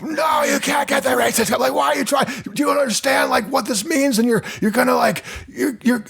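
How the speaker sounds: high, strained parody of a ranting dad; mock dad yelling. Dad scolding kid over a cereal request, mock yelling high. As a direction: shriek it like an overblown angry father. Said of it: "it'd be like".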